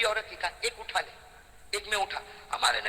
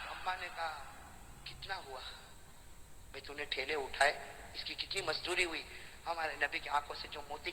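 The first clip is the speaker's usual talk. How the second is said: hushed telling; 5 semitones lower, 11 dB quieter than usual. Acted. Hushed tender storytelling passage.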